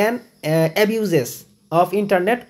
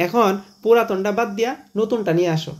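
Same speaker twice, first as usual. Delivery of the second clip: ordinary delivery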